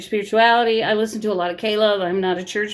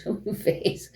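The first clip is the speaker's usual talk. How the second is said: whispered coaxing to the dog; 8 dB quieter, slower than usual. Real, not acted. Urging her shy dog on camera, whispered.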